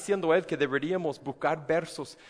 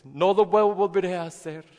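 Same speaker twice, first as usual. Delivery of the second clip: loud, raised promise never again; earnest vow; 5 semitones higher, 8 dB louder than usual. Pretend. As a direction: swear it loudly. Sinner vows never again. (promesa fuerte y exaltada)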